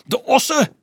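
very high outraged shriek; piercing indignant cry; shrill yell. A narrator's reading. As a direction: yelp it out high in disbelief. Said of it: "gilde Nicolaas schel".

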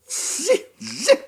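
high mouse squeak; indignant squeak. A narrator's reading. Indignant mouse squeaking at impossible cats, high. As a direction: squeak in outrage. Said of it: "возмутилась Остроноско".

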